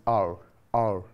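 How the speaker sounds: R drawn out low, no H sound. Shown as a demonstration. Teacher models the sound of hour for the class. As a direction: model R low and open, twice. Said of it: "we say like this".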